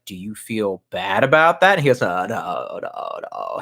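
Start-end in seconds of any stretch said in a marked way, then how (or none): 0.1-1.7 slow, lower interviewer voice; put-on low, measured question; old veteran newscaster. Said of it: "one of those old seasoned veterans who's like". 2.0-3.6 low, hushed mumbled noes; mock old-man denial, low and soft; Soros imitation. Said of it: "he literally just says, no, no, no, like this".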